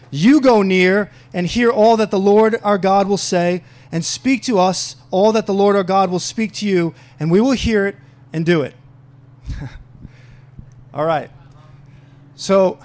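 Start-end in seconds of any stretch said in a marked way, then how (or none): 0.1-8.7 loud, forceful scripture proclamation; emphatic preacher reading the Word aloud. Acted. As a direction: declare each phrase to the whole congregation. Reads scripture aloud to congregation.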